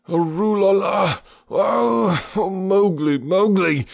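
high crying wail; moaning. A narrator's reading. Baloo moans for the stolen man-cub. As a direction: wail his name in grief. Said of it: "rolled to and fro moaning".

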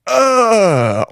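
high, falling mock-orgasm moan; hammed-up ecstatic "oh"; front-row moan. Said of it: "everyone in the front row is like".

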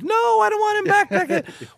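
high, loud mock scream of a mom. Son retelling his mom's shriek at him. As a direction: shriek it like an outraged mother. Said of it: "My mom just screamed".

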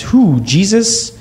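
put-on high, baffled little-boy question; young [name] baffled by mom's marriage news, high puzzled question; confused surprise.

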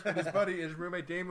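normal talking voice

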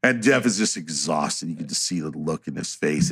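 ordinary talk, nothing marked

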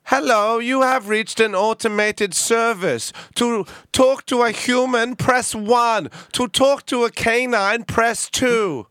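high, slow automated-service voice; drawn-out, high-pitched fake recorded announcement; automated phone message. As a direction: impersonate an automated phone menu, loudly. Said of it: "and I go".

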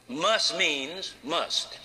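Must means must, each word slow and heavy; slow, hammered emphasis. Acted. Preacher to congregation, must means must, slow and hammered. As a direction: lay down must like a law.

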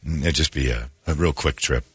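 low, soft con-man murmur; low smooth con man. Pretend. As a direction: slide the ask in quietly. Scammer asking quietly for cash.

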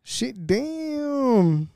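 impressed. Real, not acted. Reacts to girl's photo.

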